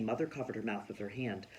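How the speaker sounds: ordinary delivery, nothing marked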